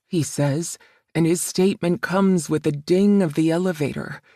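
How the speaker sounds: plain reading voice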